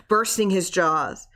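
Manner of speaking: ordinary delivery, nothing marked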